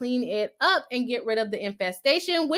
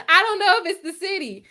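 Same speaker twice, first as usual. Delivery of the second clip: laughing; 8 semitones higher, 4 dB louder than usual. Real, not acted. Laughing at chat theory.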